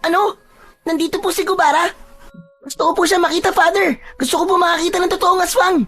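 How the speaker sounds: put-on very high, eager child voice; high-pitched boyish excitement; excited young boy. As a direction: beg like a thrilled kid to see the monster.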